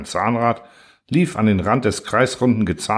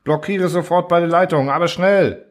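angry orders; 8 semitones higher than usual. A narrator's reading. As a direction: drive him to act now. Engineer barking orders.

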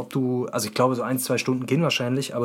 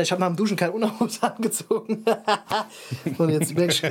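laughing through the words; giggling while confessing; 3 semitones higher than usual. Real, not acted.

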